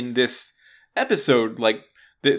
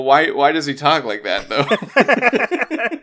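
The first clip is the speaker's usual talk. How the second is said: amused question; 4 semitones higher, 5 dB louder, slower than usual. Real, not acted. Mocking Bane accent choice.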